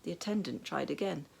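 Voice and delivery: plain reading voice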